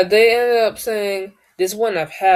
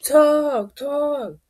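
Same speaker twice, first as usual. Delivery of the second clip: mock demand; 9 semitones higher than usual. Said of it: "start saying".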